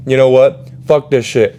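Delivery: loud fed-up outburst. Retold or imitated. Telling the teacher off before leaving, fed up. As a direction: throw it at her and quit.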